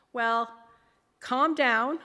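loud, measured mock dispatcher; ambulance dispatcher impression. Ambulance guy on the phone to panicking mother, mock official manner. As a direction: imitate an operator telling a caller to settle. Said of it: "you know how they are on the phone".

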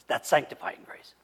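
hushed whisper. Real, not acted. Preacher whispering the key term to the congregation, hushed. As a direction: drop to a whisper to land it.

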